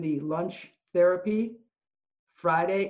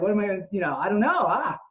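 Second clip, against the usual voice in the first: mock panic, higher pitched; retold freak-out; 5 semitones higher, 4 dB louder, faster than usual. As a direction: play up a flustered, freaked-out moment. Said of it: "freaked out".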